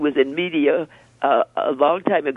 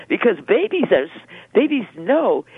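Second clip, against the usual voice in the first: ordinary delivery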